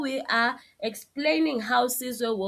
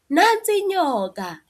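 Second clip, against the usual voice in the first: "Nansi inyoka" exclaimed higher and louder, falling; exclamation; 8 semitones higher, 6 dB louder than usual. Shown as a demonstration. Teacher showing the exclamation mark version, higher and louder. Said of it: "if there was an exclamation at the end".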